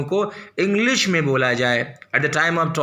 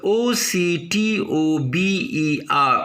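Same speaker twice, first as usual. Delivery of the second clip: normal talking voice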